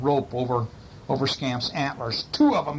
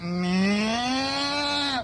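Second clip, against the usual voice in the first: high, silly meow; cow going meow; 12 semitones higher than usual. A narrator's reading. Frightened cows fleeing the creature, silly high meow. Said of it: "The cows went".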